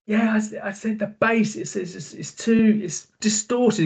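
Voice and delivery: strained high yell, retold without full volume; imitating shouting. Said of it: "we were shouting at each other".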